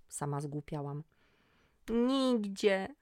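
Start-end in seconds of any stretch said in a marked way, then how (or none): 1.9-2.9 high, loud wailing cry; louder wail. A narrator's reading. Magda crying she will go nowhere, loud wail. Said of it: "jeszcze głośniej".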